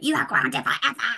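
high, exasperated outburst, lightly acted; mock-furious grounding decree; a parent grounding the son, in exasperated anger; angry parent. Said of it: "And I got really angry".

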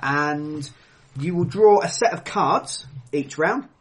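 ordinary delivery

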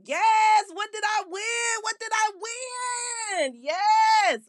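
high screaming caller; excited shriek. Acted. Lucky caller shrieking at the radio host. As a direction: scream like you just won.

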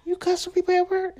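high, soft incredulous question; hushed disbelief. Retold or imitated. Daughter questioning her mother about cussing, hushed disbelief. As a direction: ask it in a small, astonished squeak.

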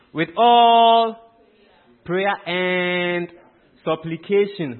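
loud, drawn-out cue for the congregation; loud verse prompt. Real, not acted. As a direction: call it out and stretch the last word. Cueing congregation to continue the verse.

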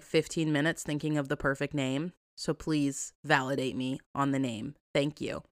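ordinary talk

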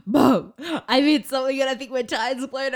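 loud, high retold panic; re-enacted frantic phone call; freaking out. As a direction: gasp out an urgent plea to mum. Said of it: "And I'm freaking out".

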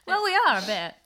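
laughing. Real, not acted. Joking reply while laughing.

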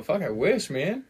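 ordinary talk

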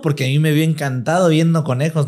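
ordinary talk, nothing marked

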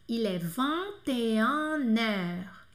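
"vingt et un heures" stretched very slowly, N linked; teacher slowing the liaison. Shown as a demonstration. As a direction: drag out every syllable. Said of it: "vai juntar com o N do an".

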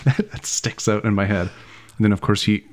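usual speaking voice

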